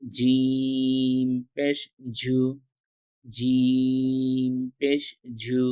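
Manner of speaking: জিম পেশ জু drawn slow with a hard Bangla j; jeem like Bangla j. Shown as a demonstration. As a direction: stretch জিম পেশ জু slowly on a firm j. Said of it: "বর্গীয় জর নিচে রস্যকার দিলে". (বর্গীয় জ-এর মতো ধীরে উচ্চারণ)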